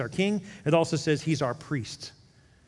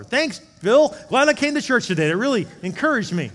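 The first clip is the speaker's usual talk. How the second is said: much higher, louder sarcastic thanks; mock sarcasm; 8 semitones higher, 9 dB louder than usual. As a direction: thank the pastor with heavy fake gratitude. Sarcastic churchgoer.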